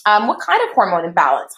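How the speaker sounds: ordinary talk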